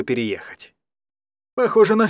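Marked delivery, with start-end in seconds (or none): 1.5-2.1 mild character voice. Acted. Даня comments on wall.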